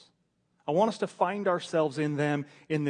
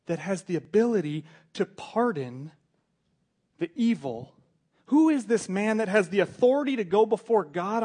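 rising, loud, amazed questions; awed wonder; 4 semitones higher, 7 dB louder than usual. Acted. The dying thief wonders who this man is, loud amazed questioning.

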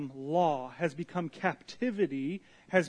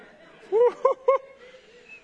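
burst of laughter; 11 dB louder than usual. Real, not acted. Laughs at own line.

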